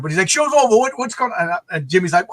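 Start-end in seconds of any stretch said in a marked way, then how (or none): 0.3-1.4 put-on high-pitched go at a British accent; a rough British accent, questioning. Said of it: "I can't do the accent".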